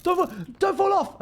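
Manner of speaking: alarmed yell at a friend near the edge; panicked shout. Real, not acted. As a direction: yelp it as they teeter.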